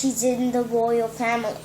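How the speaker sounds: ordinary delivery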